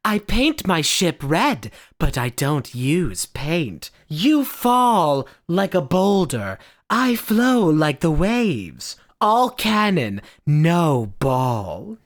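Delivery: slow, swaggering game one-liners; hammed-up game character voice; Vanessa. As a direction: declaim each boast slowly, pitch swooping. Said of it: "the character Vanessa is just, like, one-liner after one-liner".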